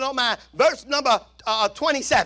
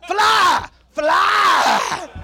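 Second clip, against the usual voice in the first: loud, higher shout of the word; shouted answer; 4 semitones higher, 6 dB louder than usual. Real, not acted. Preacher finding the slang word, loud shout. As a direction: blurt out the word. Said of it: "What's the word?".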